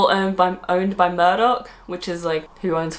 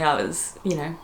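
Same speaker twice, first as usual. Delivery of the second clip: ordinary talk, nothing marked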